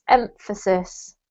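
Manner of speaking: Emphasis said slowly and clearly, every syllable laid out; careful teacher pronunciation of Emphasis; slow model word. Shown as a demonstration. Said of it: "We had emphasis".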